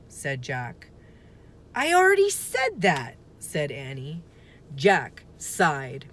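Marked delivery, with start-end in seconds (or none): 1.7-3.2 high, louder exasperated retort; impatient. Acted. Annie correcting her brother, impatient. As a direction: told you already.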